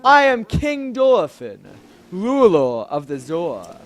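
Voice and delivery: slow, grand king introducing himself; deep manly voice. Acted. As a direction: proclaim it slowly as a manly monarch. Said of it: "let's give him a deep, manly voice".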